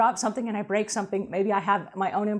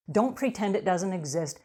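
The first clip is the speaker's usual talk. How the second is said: ordinary talk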